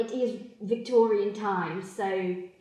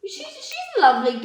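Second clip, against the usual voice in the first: high, loud, rushed defence; defensive squeak; 8 semitones higher, 4 dB louder than usual. Acted. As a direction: overprotest brightly. Defensive high-pitched praise.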